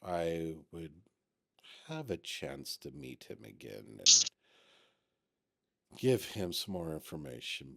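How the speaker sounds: soft, low voice with halting pauses; subdued, careful in-character reply; hesitant, regretful character. Acted. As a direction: speak low and haltingly, choosing words carefully. Game master voices a non-player character.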